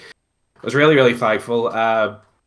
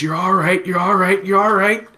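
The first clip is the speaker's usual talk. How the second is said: higher, soothing reassurance; comforting brother; 9 semitones higher, 3 dB louder than usual. Retold or imitated. Brother comforting the crying groom, higher soothing voice.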